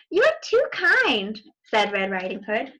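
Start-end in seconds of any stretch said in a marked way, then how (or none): 0.1-1.4 put-on slow, high girlish gasp; high, slow little girl voice; prim little girl. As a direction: clutch your pearls like a proper little girl.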